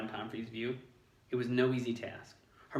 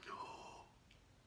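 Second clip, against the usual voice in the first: gasp; 20 dB quieter than usual. A narrator's reading. Ron grabs arm.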